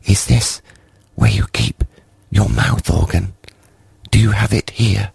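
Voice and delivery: a mild hushed high small voice; solemn whisper. A narrator's reading. Creep asking about the mouth organ, mildly hushed. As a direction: ask reverently. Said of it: "solemnly whispered".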